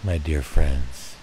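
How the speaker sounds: low, slow and soothing; tender reassurance. Real, not acted. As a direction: reassure dear friends gently, letting each phrase settle. Closing reassurance to audience.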